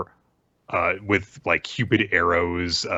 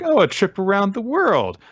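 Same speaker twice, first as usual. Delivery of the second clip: high-pitched voice with a falling end; put-on high audience voice; eager audience member; 4 dB louder than usual. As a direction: pipe up high with a suggestion.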